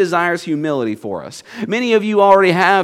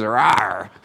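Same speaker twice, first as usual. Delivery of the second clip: low mock tiger roar; half-hearted spoken roar; 8 semitones lower than usual. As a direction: imitate a tiger with a small, low growl. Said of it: "And the tiger says".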